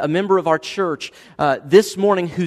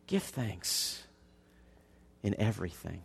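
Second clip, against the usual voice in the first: much softer, lower repeat; 8 semitones lower, 15 dB quieter than usual. Shown as a demonstration. Pastor quietly repeating the command to the church, soft. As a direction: drop it to a hush. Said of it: "repeated point, softer".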